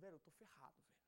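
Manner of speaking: low, hushed admission of trouble; dejected mutter. Acted. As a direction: say it under your breath, like a worker who messed up. Voicing a worker who erred.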